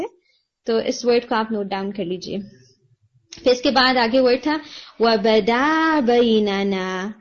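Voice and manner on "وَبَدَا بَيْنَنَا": وَبَدَا بَيْنَنَا recited slowly with the alif of بَدَا held long; recitation demo. Shown as a demonstration. Teacher reciting the missed long alif for the student, slowly. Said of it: "یہاں پر بھی آپ کا الف مدیہ مس ہوا".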